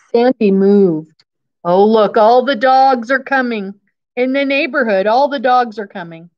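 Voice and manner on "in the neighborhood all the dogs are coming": usual speaking voice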